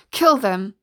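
loud yelled order; forceful shouted attack order. A narrator's reading. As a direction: shout the command furiously. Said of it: "the sheriff yelled".

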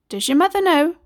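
high, kindly West Country mum; concerned neighbour. Acted. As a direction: ask gently but pointedly. Neighbour questions runaway child.

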